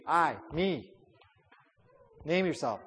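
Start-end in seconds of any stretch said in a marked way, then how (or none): 0.1-0.9 "I" and "Me" each set apart; stress on the self. Shown as a demonstration. Teacher pointing the verse at oneself, stress on I and Me. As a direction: give "I" and "Me" a beat of their own. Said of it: "Put the emphasis on me".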